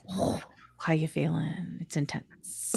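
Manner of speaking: gentle concern. Real, not acted. Sympathy over injection.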